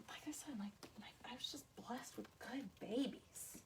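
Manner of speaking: whisper, as if confiding; hushed, unvoiced confession to viewers; whispered aside. Real, not acted. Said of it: "drops to whisper".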